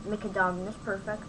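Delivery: ordinary talk, nothing marked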